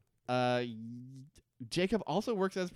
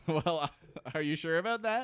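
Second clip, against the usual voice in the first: laughing tease; 4 semitones higher than usual. Real, not acted. Laughing, teasing.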